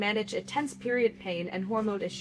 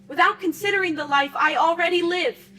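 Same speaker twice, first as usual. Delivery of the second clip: higher, louder indignant plea; impassioned protest; 5 semitones higher, 4 dB louder than usual. Acted. Performer protesting to an audience that her own life needs care, impassioned. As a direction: press each point home with rising force. Impassioned monologue on threatened reproductive rights.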